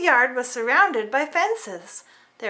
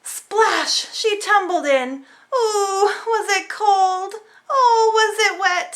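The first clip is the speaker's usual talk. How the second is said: higher, louder, slowed mock shivering; dramatic splash; 6 semitones higher, 5 dB louder, slower than usual. A narrator's reading. Storyteller acting the kitten's cold dunk, hammed-up. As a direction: ham up the cold wet shock.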